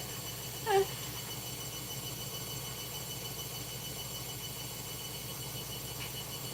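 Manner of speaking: long breathless wheezing laugh; silent laugh. Real, not acted. Wheezing silently at a chat comment, long laugh. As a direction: shake with near-silent laughter.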